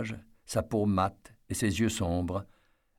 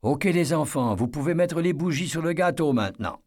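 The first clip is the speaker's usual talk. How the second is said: put-on higher, louder call to the kids; 7 semitones higher, 3 dB louder than usual. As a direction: call across the yard to the children. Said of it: "Marguerite crie".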